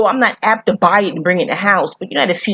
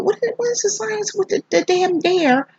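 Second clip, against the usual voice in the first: high, softer retold bafflement; bewildered; 11 semitones higher, 4 dB quieter than usual. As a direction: act out baffled questioning, pitched way up. Acts out bewilderment at the film.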